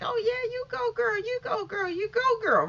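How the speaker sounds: high excited cheer; squealing you-go-girl cheer. Retold or imitated. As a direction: hype her up like a fan.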